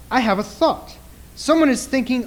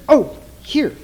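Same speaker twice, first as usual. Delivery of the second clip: normal talking voice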